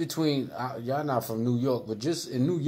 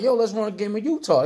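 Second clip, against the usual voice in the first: retold call out; 8 semitones higher, 4 dB louder, faster than usual. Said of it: "I'm like".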